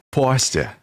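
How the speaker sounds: mock New York "pasta"; New York accent. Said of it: "you say it with a New York accent".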